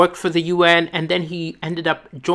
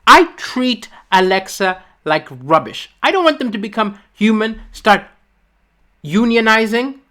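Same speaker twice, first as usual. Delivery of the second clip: usual speaking voice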